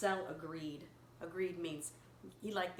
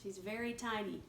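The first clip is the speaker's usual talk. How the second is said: ordinary delivery, nothing marked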